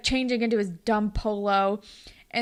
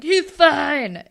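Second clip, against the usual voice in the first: loud retold insistence, higher-pitched; defensive outburst; 5 semitones higher, 10 dB louder than usual. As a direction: imitate shutting down any doubt, loudly. Retold Casey defending coach again.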